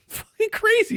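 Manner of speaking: high, breaking into laughter; laughing. Real, not acted. Comic amazed that accents get dropped here, laughing. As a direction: squeeze the words out through a real laugh. Cracking up at own bit.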